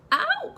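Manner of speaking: piercing high ow of pain; loud squeal. A narrator's reading. Piglet scrubbed in the bath, squealing loud and high. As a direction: yelp as the flannel scrubs. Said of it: "cried Piglet".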